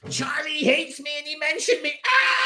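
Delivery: very high squeaky mock voice ending in a shriek; mimicking the spammer Marcus, high squeaky whine; mock whiny squeal. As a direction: squeak it like a whining kid. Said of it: "he's going".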